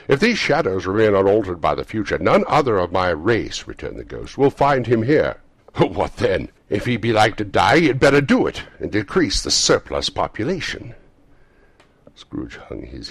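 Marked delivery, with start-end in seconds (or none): 0.1-3.7 loud, raised, stern pronouncement; ghost's rebuke. A narrator's reading. Ghost rebukes Scrooge with his words. 4.4-11.0 loud, high, cutting scorn; scornful ghostly rebuke. A narrator's reading. Ghost throws Scrooge's words back.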